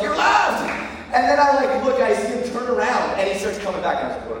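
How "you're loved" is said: high, squeaky timid blurt. Retold or imitated. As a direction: blurt it out nervously.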